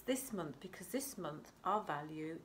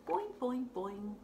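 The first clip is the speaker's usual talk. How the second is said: higher, playful bounces; bouncy boing; 7 semitones higher than usual. Real, not acted. Reader hops along for the children, playfully. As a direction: bounce each boing like a spring.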